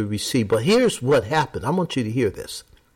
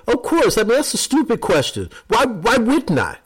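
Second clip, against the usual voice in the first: higher, quick, scoffing voice for the sceptics; mocking the doubters; 8 semitones higher than usual. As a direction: toss it off as if the question were stupid. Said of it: "most of the time people will say".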